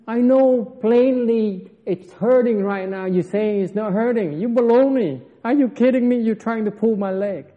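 loud, high-pitched scolding master; 스승의 호통. Retold or imitated. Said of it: "That's what he means".

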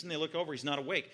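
ordinary talk

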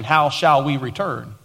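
usual speaking voice

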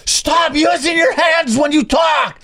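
high, slow imitated yell at someone; retold scream. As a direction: shriek it like an angry stranger. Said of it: "screaming at me".

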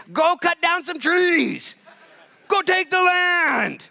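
high, loud, slow shouted command; tough dad. Acted. Preacher voices the Lord as tough dad telling believer to act, shouting. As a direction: bark it like a coach-dad pushing his kids. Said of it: "The toughness of a dad".

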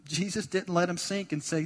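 ordinary talk

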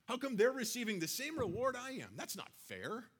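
high, indignant worker's whine; mock grumbling of an offended worker; whiny complaining. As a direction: protest like a sulking laborer. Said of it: "they start complaining".

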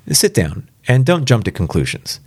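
plain reading voice

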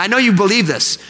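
usual speaking voice

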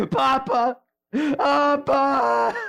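high, loud wailing call to a dead father; anguished crying. Retold or imitated. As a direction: cry out in grief. Jesus cries over dead father.

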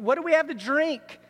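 mildly exasperated crying out, imitated; mock complaint. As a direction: act out a thirsty grumble. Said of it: "They're crying out".

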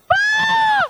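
very high, loud demonstrated scream; terrified cry. Shown as a demonstration. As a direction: let out a mock shriek of fright. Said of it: "The word cried is like an inaudible sound".

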